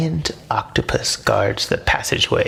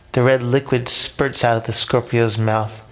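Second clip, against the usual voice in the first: ordinary delivery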